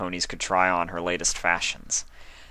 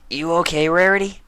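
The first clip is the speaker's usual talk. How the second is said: high, louder tomboy pony; Rainbow Dash voice; 11 semitones higher, 6 dB louder than usual. A narrator's reading. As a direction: ask it bluntly. Rainbow asking.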